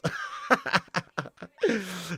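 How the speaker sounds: real burst of laughter after cheering; delighted laugh. Real, not acted. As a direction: laugh it out happily.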